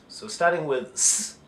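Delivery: ordinary talk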